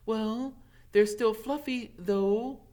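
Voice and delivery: lightly voiced clever girl; Hermione. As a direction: correct him primly.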